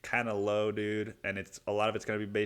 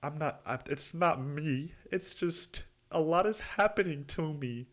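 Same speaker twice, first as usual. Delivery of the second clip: mock your own self-pity, pitched up; victim mentality; 7 semitones higher than usual. Client protesting to his therapist, mock victim. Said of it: "just the fucking victim mentality".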